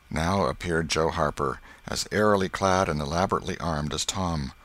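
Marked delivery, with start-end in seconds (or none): none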